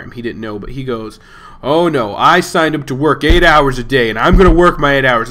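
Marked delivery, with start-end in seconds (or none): 1.6-5.3 louder, insistent protest; retold flipping out. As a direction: dig in and refuse to go home. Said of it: "This guy starts flipping out".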